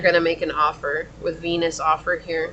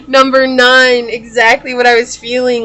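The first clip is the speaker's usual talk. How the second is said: excited; 6 semitones higher, 9 dB louder than usual. Real, not acted. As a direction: proclaim it with delight. Excited card confirmation.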